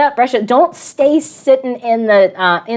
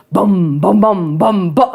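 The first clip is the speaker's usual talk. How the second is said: low booming bum bum of a car stereo; lower, hummed bass beat; mock bass thump; 6 semitones lower, slower than usual. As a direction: thump it out like a subwoofer. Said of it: "the whole thing starts to vibrate and goes".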